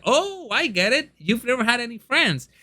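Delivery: a hint of a naive, friendly hero; innocent hero. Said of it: "in a very innocent and heroic way".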